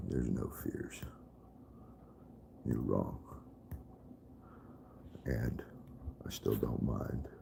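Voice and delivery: hushed, breathy aside; a real whispered aside. Real, not acted. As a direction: drop to a near whisper to the chat. Quiet aside to the chat.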